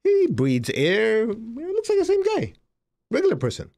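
mock wonder. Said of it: "I was thinking".

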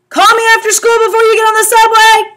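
high, loud and fast call; yelled from window. A narrator's reading. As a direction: shout down from the window. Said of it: "Mom yelled at me from the window".

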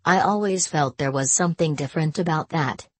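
ordinary delivery

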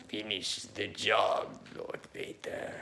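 slow, breathy Lord Vader; the Emperor. Retold or imitated. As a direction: drop the voice to a soft, slow Vader command. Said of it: "Okay, the Emperor".

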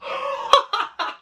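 high-pitched burst of laughter; real laugh at a shocking jump; helpless laugh. Real, not acted. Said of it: "I'm just laughing at the".

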